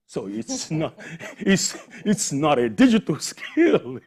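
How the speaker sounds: amused, laughing through the words. Real, not acted. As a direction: laugh at the idea while saying it. Mocking mom using TikTok.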